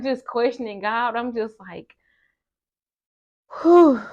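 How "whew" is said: loud, high exhaled "whew"; bracing whew. Retold or imitated. Student bracing to accept it's time, big whew.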